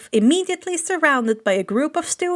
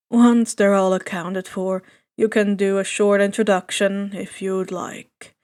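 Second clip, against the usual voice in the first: lower, level teacher voice; matter-of-fact and low; tired teacher; 5 semitones lower than usual. A narrator's reading. Teacher explaining the class plan, low and level.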